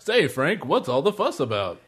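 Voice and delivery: feed the setup like a corny commercial; mock-helpful salesman; chipper ad pitchman. Plays helpful ad friend.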